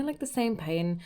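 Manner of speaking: normal talking voice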